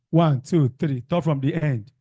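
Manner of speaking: ordinary talk, nothing marked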